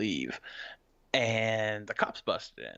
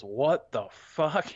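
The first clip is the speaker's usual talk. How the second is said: slow, drawn-out disbelief, pitched up; higher, slowly stretched mock amazement; retold astonishment; 5 semitones higher, 4 dB louder, much slower than usual. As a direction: imitate a stunned reaction, stretching the words. Retold stunned reaction of girlfriend.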